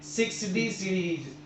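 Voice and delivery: slow, soft, stretched-out words; drawn-out answer. Real, not acted. Teacher completing the class's answer, slow and drawn-out. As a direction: let the answer out slowly and quietly.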